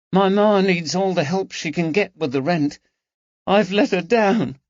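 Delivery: put-on a young maid close to crying; a girl ashamed she failed her mother; voice trembling. A narrator's reading. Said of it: "her voice trembled".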